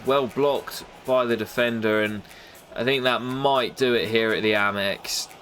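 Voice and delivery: ordinary talk, nothing marked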